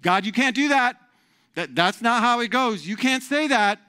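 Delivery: high, loud fussy protest; hammed-up indignant objection; objecting religious leaders. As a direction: forbid it as a scandalized official. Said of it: "the ones who are like".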